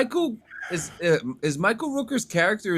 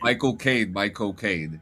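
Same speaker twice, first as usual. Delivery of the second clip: put-on Michael Caine accent; 8 semitones lower than usual. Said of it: "the way he says it".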